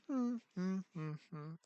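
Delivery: high, soft humming through closed lips; lightly imitated tight-lipped smile noises; closed-lip smile hums. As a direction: hum like smiling without showing teeth. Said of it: "she would always smile with her lips".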